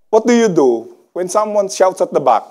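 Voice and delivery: ordinary talk, nothing marked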